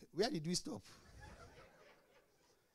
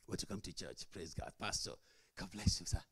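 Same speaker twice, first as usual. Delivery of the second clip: lightly mock pious churchgoer; 10 semitones lower, 7 dB quieter than usual. Mocking churchgoers' pious greeting.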